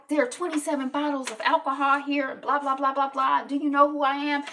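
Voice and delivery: high, pushy star demand; a touch of a self-important celebrity; lightly voiced cocky Kevin. Kevin lectures the housekeeper about the bottles, mildly pushy.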